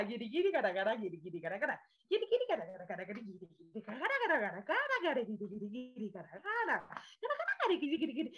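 bouncy nonsense chatter, pitch jumping up and down; gibberish Guirigay. Shown as a demonstration. Teacher joining the class in gibberish practice. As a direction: let the made-up words tumble out freely. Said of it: "dejen que salga".

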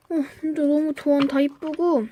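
ordinary talk

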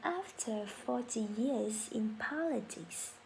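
very slow, syllable by syllable teacher read; slow model sentence. Shown as a demonstration. Teacher reading for class to repeat, very slow. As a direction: drag it out so learners can follow. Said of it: "Very good".

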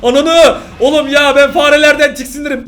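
loud, high outburst of disgust; disgusted shout. Real, not acted. Viewer shouting in disgust at a rat on screen, loud and high. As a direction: yell out in revulsion. Reacts to rat jump scare.

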